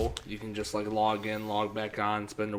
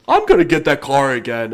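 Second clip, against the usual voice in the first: mocking friend; 3 semitones higher, 13 dB louder than usual. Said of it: "then you're like".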